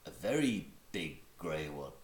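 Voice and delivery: ordinary delivery, nothing marked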